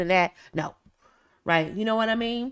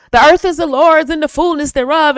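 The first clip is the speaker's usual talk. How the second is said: mock scripture reading; 6 semitones higher, 6 dB louder than usual. Quoting scripture, raised voice.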